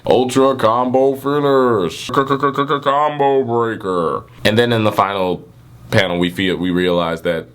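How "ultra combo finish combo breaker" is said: very slow, drawn-out announcer call; game announcer. Pretend. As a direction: boom it out like an arcade announcer. Fighting game announcer impression.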